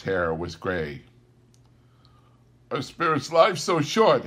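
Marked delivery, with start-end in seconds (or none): 2.7-4.3 Scrooge. Acted. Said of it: "asked Scrooge".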